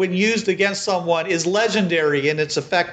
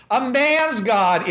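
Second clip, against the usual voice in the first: slow, mocking "A man's god", pitched a bit higher; sarcastic woman; 4 semitones higher, slower than usual. Marie mocks the priest's words, slow and sarcastic. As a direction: throw his own words back at him. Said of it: "you hear this female voice says".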